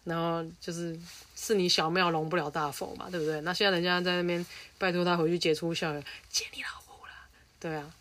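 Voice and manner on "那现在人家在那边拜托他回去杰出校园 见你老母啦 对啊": hushed conspiratorial whisper; whispered aside. Acted. Podcaster mocking the old school in a whisper. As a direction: whisper the jab like a secret. Sneaky insult aside to listeners.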